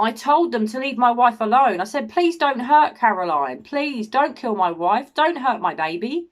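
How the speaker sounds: loud, high, desperate begging; acted-out frantic appeal, pitched high; urgent pleading. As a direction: plead for your family's lives. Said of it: "There's no urgency".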